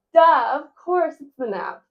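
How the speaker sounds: hamming up an obvious realization; retold self-mocking duh about the nap. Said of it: "and I'm like".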